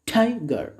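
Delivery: "Tiger" in two beats, weight on the first; first syllable stressed. Shown as a demonstration. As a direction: punch TI in "Tiger", then let ger fall. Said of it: "the first syllable has been stressed".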